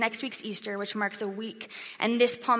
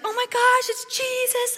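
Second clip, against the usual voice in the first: squealing high with excitement; thrilled gushing; 13 semitones higher, 4 dB louder than usual. Retold or imitated. Preacher voicing the crowd seeing Jesus arrive, high thrilled squeal. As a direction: gush like a starstruck fan.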